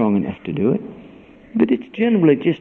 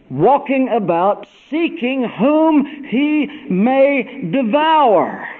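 louder, higher, slow warning about the devil; preaching at full force; 8 semitones higher, 6 dB louder, slower than usual. Acted. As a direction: thunder it like a roaring lion.

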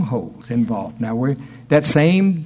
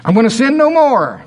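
mock vow; 10 semitones higher, 6 dB louder than usual. Mock resolve of a lawbreaker.

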